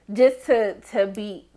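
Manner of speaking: usual speaking voice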